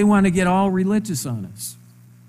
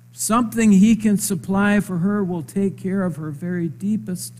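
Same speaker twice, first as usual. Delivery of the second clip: ordinary talk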